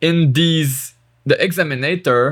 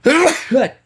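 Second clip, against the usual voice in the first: cough; 13 semitones higher than usual. Real, not acted. Sudden cough.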